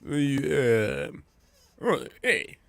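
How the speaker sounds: John Travolta. Retold or imitated. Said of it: "are you doing John Travolta?".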